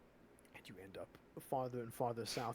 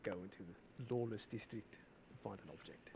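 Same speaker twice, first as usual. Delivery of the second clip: put-on Afrikaans accent. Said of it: "My tongue is tired from doing an Afrikaans accent".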